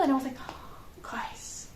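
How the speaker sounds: whisper. Retold or imitated. Shocked whisper at everyone fine.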